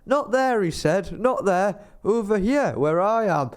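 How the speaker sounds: mock shout of not there, over here; calling out. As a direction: beckon from across the cinema. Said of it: "waving his arms frantically".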